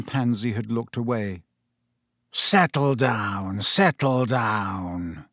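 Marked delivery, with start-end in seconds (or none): 2.3-5.2 slow, lazy and unbothered; idle. A narrator's reading. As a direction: drawl it out without interest. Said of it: "said Professor Snape idly".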